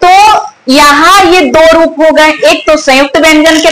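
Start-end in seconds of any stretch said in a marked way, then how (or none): none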